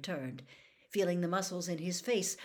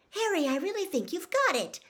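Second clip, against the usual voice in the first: high, louder, thrilled praise; delighted; 12 semitones higher, 6 dB louder than usual. A narrator's reading. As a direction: exclaim it with delight, pitched well up. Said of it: "said Hermione delightedly".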